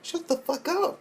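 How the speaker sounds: high squeal. Acted. Opening rant outburst.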